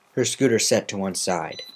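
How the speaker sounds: plain reading voice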